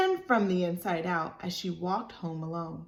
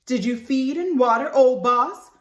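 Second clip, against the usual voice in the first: loud call from a window, higher; Mama calling out; 5 semitones higher, 10 dB louder than usual. Acted. Mama calling to Libby from the window, loud. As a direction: call across the yard to the child. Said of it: "Mama called from her sewing room window".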